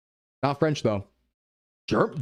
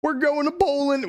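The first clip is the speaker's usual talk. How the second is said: excited; 12 semitones higher than usual. Real, not acted. Announces pick excitedly.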